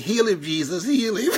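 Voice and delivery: lightly mimicked healer's prayer, rising; faith healer. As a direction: imitate a healer calling on Jesus, building. Acting out a healer praying over him.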